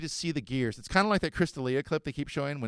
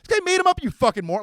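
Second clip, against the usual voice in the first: put-on much higher and louder teen yell; kid yelling; 10 dB louder than usual. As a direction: scream it at his buddy.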